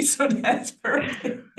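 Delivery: breathy laugh through the words, slowing down. Real, not acted. Guest to host, laughing off the name worry. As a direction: let a real laugh carry the words out.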